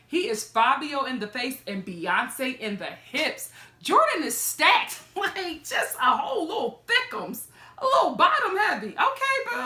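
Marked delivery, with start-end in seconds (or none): 3.8-9.7 excited. Real, not acted. Hyping Jordan's hips.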